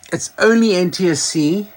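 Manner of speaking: ordinary delivery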